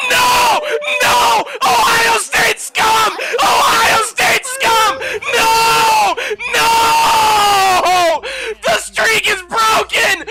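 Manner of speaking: screaming no in despair; anguished screaming. Real, not acted. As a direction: wail it out, devastated. Team picks hated player.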